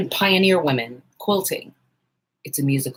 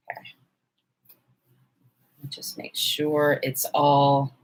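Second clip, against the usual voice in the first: whispering to herself; 3 semitones lower, 4 dB quieter, slower than usual. Real, not acted. Muttering while pinning.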